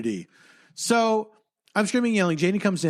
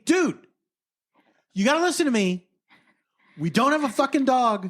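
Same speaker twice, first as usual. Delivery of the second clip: mild mock yell, pitched higher; retold screaming; 5 semitones higher than usual. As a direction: replay the scream, lightly. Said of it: "So I start fully screaming".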